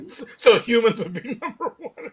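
giggling through the words; half laughing it out. Real, not acted. Laughing at the villain quote.

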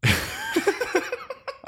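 shrill burst of laughter; high squealing laugh. Real, not acted.

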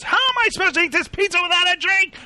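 high, fast mock outrage; angry customer. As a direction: squeal the complaint quickly. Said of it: "And he goes".

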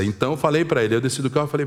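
ordinary talk, nothing marked

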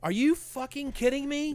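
high, slow incredulous mock voice; hammed-up disbelief; stunned disbelief. Mock reaction of stunned listener.

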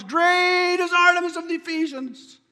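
high, loud mob cry, acted out; imitated crowd scream. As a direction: belt it out like screaming craftsmen. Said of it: "screaming to the top of their lungs".

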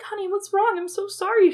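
high-pitched, distressed fussing; mock tearful mom voice; imitated emotional mother. Mother to her crying child after surgery, imitated emotional plea. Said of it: "She was, like, so emotional".

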